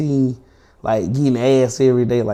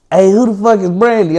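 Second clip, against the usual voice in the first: retold angry demand, higher and louder; confrontational questioning; 5 semitones higher, 5 dB louder than usual. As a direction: act out a girlfriend demanding answers. Girlfriend interrogating him in retold quarrel.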